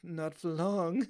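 slow, sinister; slow menacing line. Retold or imitated. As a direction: drag it out like a threat. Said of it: "he just goes".

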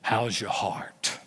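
low, slow deep-voiced old pastor; old pastor friend on the phone, deep slow voice. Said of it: "He's got this deep voice".

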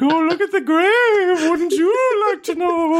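very high, slow ghostly wail; unintimidating ghost voice. Acted. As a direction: try to haunt them and sound ridiculous. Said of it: "That voice isn't very intimidating".

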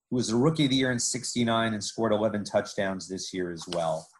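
usual speaking voice

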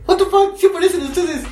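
high-pitched shocked outburst; shocked disbelief. Retold or imitated. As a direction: blurt it out in disbelief. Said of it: "fue como de".

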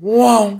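short imitation engine vroom; race car noise. Shown as a demonstration. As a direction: echo the car zooming past. Joins in NASCAR transition noise.

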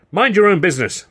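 high, loud angry retort; angry cry. A narrator's reading. Woman yanking the boy away from the stranger, loud angry cry. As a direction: snap back and drag him away. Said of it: "the woman cried".

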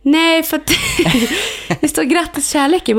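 high, laughing retelling; laughing through a retold line. Explaining the neighbour's card to her ex, laughing. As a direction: breaks into a laugh mid-quote while retelling.

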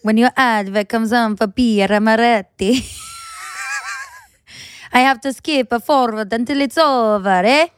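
put-on, hammy Italian accent; slightly slowed mock-Italian accent. Shown as a demonstration. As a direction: read it out as a comedy Italian. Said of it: "Do you want to do Italian accent".

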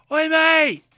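high, loud yob challenge; mock shout. Generals shouting at the retreating Hannibal, loud and high. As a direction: bellow it across the field like a lout. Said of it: "they shout".

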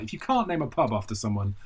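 ordinary talk, nothing marked

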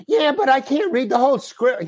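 high pitched frustrated protest; exasperated. Retold or imitated. Actor to the director, high frustrated protest. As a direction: push back, fed up.

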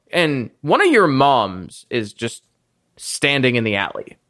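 usual speaking voice

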